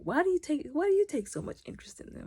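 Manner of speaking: high, fast needling question; sly retort. Retold or imitated. Mentor needling Harwin about the kids, sly. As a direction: turn it back on him slyly. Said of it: "And he was like".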